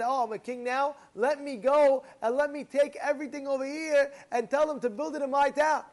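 high, loud, emphatic retelling; excited scheming. Retold or imitated. Smart king seizing his chance, high excited delivery. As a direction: push each phrase out high and loud, acting the eager king. Smart king's plan acted out.

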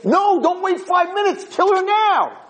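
very high, urgent acted yell; screaming. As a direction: yell an urgent order through glass. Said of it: "They think he's screaming".

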